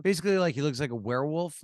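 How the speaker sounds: ordinary delivery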